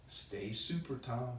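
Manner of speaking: ordinary delivery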